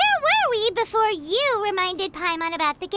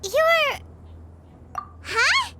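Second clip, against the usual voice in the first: high, astonished disbelief; surprised; 6 semitones higher, slower than usual. Acted. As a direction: gasp it out in shock. Paimon realizes the lady is Cloud Retainer.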